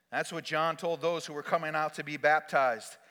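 ordinary talk

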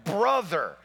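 loud, high, driving sermon delivery; full-force preaching. Acted. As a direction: preach it out to the whole church.

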